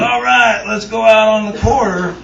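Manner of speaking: put-on drunken rallying call. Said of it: "I stood up and said".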